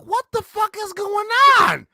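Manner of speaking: jolted-awake confused yell; shocked outburst. Retold or imitated. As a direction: cry out in total confusion. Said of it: "And I'm like".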